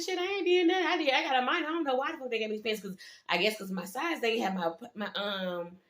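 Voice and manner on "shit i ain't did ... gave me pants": high-pitched, defensive retelling of her own words; retold defensive answer. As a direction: play it up, protesting innocence. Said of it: "I was like, I said".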